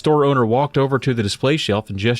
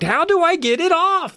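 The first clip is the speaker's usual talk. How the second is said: squeaky high, desperate begging; begging girl. A narrator's reading. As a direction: beg like a panicked kid Carly Beth. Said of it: "Carly Beth begged".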